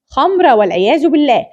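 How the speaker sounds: ordinary talk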